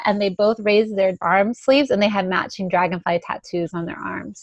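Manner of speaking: normal talking voice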